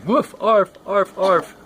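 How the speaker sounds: high, loud pleading yaps; whiny barks. Acted. Dog rolling over begging, high whiny yaps. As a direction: whimper out the barks. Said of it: "with a whimper and a whine".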